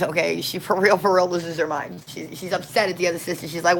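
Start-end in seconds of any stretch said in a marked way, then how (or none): none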